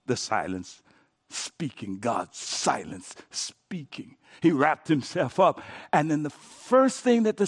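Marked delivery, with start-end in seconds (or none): 0.1-4.2 much lower, soft, slow; hushed awe. Shown as a demonstration. Preacher dwelling on God's silence speaking, hushed and low. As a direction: murmur it like a secret. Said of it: "hushed repetition of silence".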